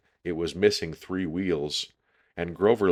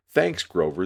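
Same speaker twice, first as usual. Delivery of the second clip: plain reading voice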